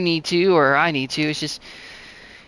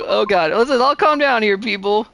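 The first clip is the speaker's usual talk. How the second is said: usual speaking voice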